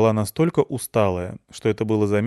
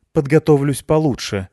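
stubborn; 7 semitones higher, 6 dB louder than usual. Acted. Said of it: "ответила девушка упрямо".

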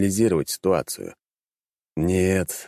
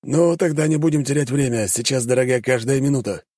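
brisk soldier; 6 semitones higher than usual. A narrator's reading. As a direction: hurry them along briskly. Gorky voiced by narrator.

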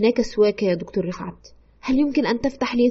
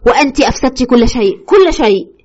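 louder, rising accusation; fierce accusation; 6 dB louder than usual. Retold or imitated. Husband's spirit accusing his wife, louder and building. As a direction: throw the blame at her, building. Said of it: "بلهجة عاتية الاتهام".

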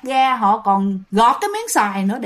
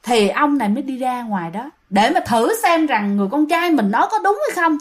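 normal talking voice

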